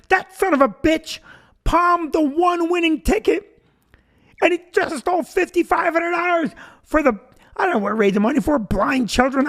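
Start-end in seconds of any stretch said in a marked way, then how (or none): 0.1-7.2 high-pitched, indignant accusation; worked-up mock fury, pitched high; mock outrage. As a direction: denounce the cheat in comic outrage. Voices his own imagined accusation.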